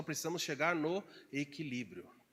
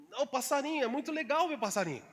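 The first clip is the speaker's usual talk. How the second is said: giddy rush; 8 semitones higher, faster than usual. Retold or imitated. Mimics overly cheerful person.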